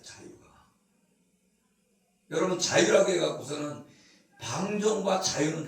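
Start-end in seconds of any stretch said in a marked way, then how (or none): 0.0-0.5 whispered. Real, not acted. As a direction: whisper the word away. Trailing whispered tag word.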